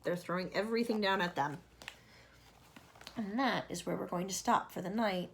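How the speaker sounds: ordinary delivery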